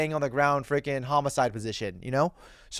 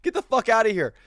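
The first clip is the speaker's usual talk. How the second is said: higher, hammed-up yell as the commissioner; mock angry commissioner; 6 semitones higher than usual. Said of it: "the commissioner said".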